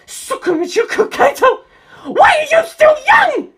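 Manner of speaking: high, loud, shrill demand; a boy shrieking at a ghost; shrieking Antonio. A narrator's reading. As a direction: scream the question at him.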